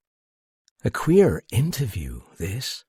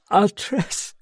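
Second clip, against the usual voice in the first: tearful; 11 semitones higher than usual. A narrator's reading. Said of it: "She was crying now".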